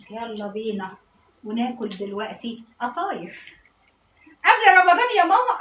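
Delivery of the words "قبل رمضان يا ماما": put-on high, loud child's question; raised, calling voice of a questioning child; child questioning mama. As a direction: pipe up like a kid asking mum.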